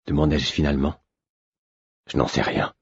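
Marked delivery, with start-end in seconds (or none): 2.1-2.8 low, soft murmur; lightly voiced stablemaster murmuring low; under his breath. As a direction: say it half-voiced, holding back. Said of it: "fit-il à mi-voix".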